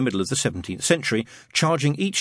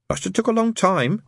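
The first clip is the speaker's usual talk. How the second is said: put-on higher child's voice, mildly sceptical; doubtful; 10 semitones higher than usual. A narrator's reading. Child challenges friend's tunnel theory, doubtfully. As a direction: question it with a touch of doubt. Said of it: "said Pepper doubtfully".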